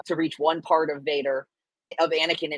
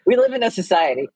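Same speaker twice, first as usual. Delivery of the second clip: louder meme catchphrase; meme delivery; 4 semitones higher, 8 dB louder than usual. Pretend. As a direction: announce the meme line. Meme quote.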